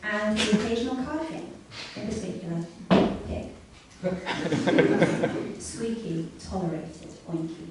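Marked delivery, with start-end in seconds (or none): 4.0-5.6 laugh. Real, not acted. Narrator laughs at own story.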